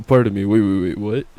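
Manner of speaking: loud laughing double take; laughing disbelief. Real, not acted. Laughing disbelief at story twist.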